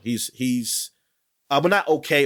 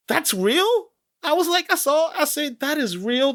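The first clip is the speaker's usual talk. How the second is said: incredulous; 12 semitones higher than usual. Retold or imitated. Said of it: "I'm like".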